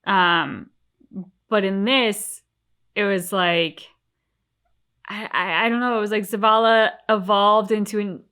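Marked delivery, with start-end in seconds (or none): none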